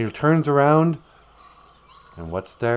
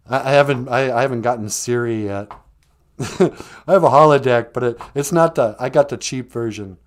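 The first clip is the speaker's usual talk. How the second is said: usual speaking voice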